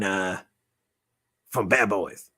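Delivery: usual speaking voice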